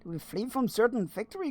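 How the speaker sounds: usual speaking voice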